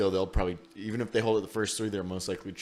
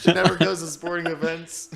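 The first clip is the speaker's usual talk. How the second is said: laughing while talking; 8 semitones higher, 10 dB louder than usual. Real, not acted. Laughing at mom's raffle luck.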